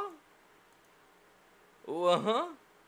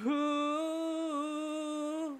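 leering oh mama; 13 semitones higher, much slower than usual. Acted. Drawn-out leering reaction.

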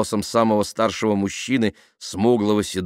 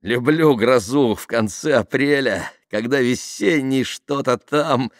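higher, slow, lilting recitation; amused; 6 semitones higher, 4 dB louder, slower than usual. A narrator's reading. Tamara quoting verse in a downpour, amused. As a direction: recite the verse playfully, stretching it out. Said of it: "засмеялась Тамара".

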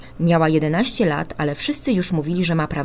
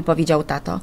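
usual speaking voice

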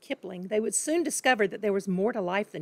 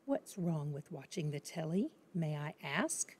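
soft and dangerous; 4 semitones lower, 12 dB quieter than usual. Acted. Father turning on his daughter, soft and dangerous. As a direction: hush it like a quiet threat. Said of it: "soft and dangerous".

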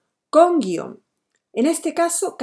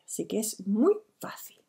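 whispered aside; 6 dB quieter than usual. Real, not acted. Teacher's aside after the rule.

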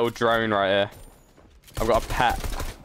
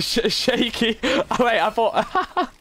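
high, stuttering through laughter; words breaking into real laughter; laughing hard; 9 semitones higher, much slower than usual. Real, not acted. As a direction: try to talk while cracking up. Cracking up over the player.

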